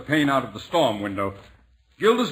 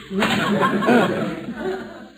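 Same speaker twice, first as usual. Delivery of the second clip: gloating laugh; 7 semitones higher than usual. Acted. Bullard gloats over his joke.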